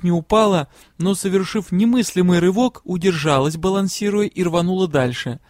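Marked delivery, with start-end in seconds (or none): none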